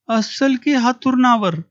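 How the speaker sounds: high, slow, unconcerned answer; a drowsy wife brushing it off; sleepy wife. A narrator's reading. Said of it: "बायको उत्तरली".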